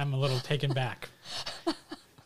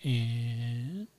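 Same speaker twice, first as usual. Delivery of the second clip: whispering. Real, not acted. Whispered table talk.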